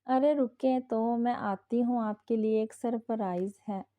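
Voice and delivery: ordinary delivery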